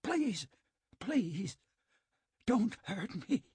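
high, pleading plea for mercy; begging. A narrator's reading. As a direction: beg desperately in a high voice.

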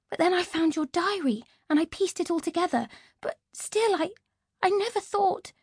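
the relieved sister, still half crying; tearful twin sister. Acted. Ivy's voice, tearful.

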